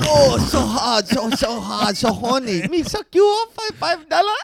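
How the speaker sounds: high, soft mock Korean accent; breathy mock accent, hammed up. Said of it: "How's my Korean accent?".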